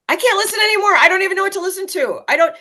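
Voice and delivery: high, loud mock-desperate wail; exasperated student outburst. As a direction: act out an overwhelmed student at wit's end. Retold exasperated college player's outburst.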